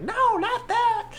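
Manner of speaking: tiny, very high stretched-out squeal; faint voice on phone. Retold or imitated. Schwartz's mom reacts over the phone, faint squeal. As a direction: imitate a shocked voice heard through a receiver. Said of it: "we could hear her voice, like, faintly".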